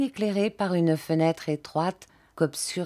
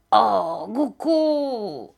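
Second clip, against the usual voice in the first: put-on high, eye-popping old man's yelp; energetic wordless cry; 4 semitones higher, much slower than usual. A narrator's reading. Old man ends his rant, high energetic cry. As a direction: throw the cry out with all your energy. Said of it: "il poussa ce dernier cri avec une telle énergie".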